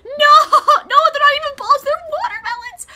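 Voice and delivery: high, loud, giddy squealing; excited squeal. Real, not acted. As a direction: squeal in delight at the watermelons. Surprised by scattered watermelons.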